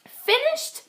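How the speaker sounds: ordinary delivery, nothing marked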